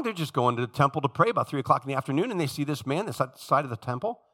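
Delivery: usual speaking voice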